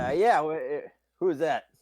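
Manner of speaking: high, loud, stammered challenge; startled guard. Acted. Steiny answering a stranger at the grate, startled. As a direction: call out as a puzzled guard.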